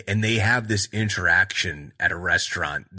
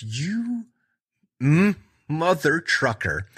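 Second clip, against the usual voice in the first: high, slow, hammed-up fury; mock seething curse; 7 semitones higher, slower than usual. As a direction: drag out the curse like he's boiling over. Brandon glares after beer thrown.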